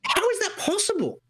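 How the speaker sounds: mock disbelief asking the doctor; retold disbelief. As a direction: sound baffled by the news.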